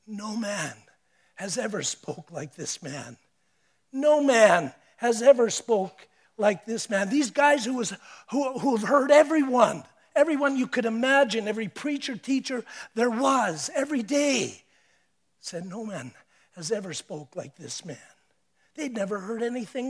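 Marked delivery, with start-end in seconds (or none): 0.1-3.2 softer, hushed awe; awed hush. Acted. Officers admitting to the priests, softer and hushed. Quoted officers, soft then repeated. 3.9-7.1 louder, firm declaration; louder repeat. Acted. Officers insisting before the priests, louder. 15.6-18.0 much softer, lower awe; hushed awe. Acted. Guards telling the priests in soft awe.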